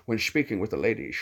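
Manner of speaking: Scottish voice from the side of the mouth; Sean Connery. Shown as a demonstration. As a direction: talk out the side of your mouth, low and slushy. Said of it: "Sean Connery is a little more like this".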